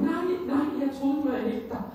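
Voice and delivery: high, loud relieved panic; imitating a frightened sister at the door; panicked sister. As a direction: blurt it out like the sister who feared the worst. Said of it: "så sagde hun".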